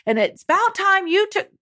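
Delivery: high-pitched whiny griping; childish complaining. Acted. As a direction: whine it like an aggrieved child. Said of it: "Don't be like".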